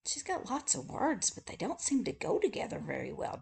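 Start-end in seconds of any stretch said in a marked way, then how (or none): none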